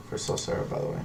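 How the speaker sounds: unvoiced whisper; whispered aside. Real, not acted. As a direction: breathe it under your breath. Muttered aside.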